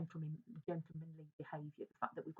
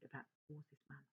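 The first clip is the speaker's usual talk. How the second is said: ordinary talk, nothing marked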